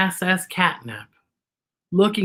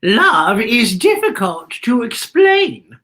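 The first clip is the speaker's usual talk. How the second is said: put-on slow, louder thoughtful old woman; Beatrice's voice; 4 semitones higher, 6 dB louder, slower than usual. A narrator's reading. Beatrice explaining love to Z. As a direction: weigh each word like a wise grandmother. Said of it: "said Beatrice".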